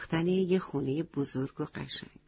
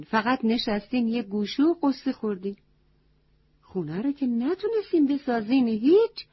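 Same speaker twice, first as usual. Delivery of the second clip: higher and louder, a stern goat telling them off; scolding wise goat; 6 semitones higher, 5 dB louder than usual. Acted. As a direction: wise goat scolding the moping animals, raised and sharp. Wise goat scolds sad animals.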